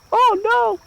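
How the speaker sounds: high squeaky oh no; mock alarm. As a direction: yelp it in mock panic. Character about to pass out.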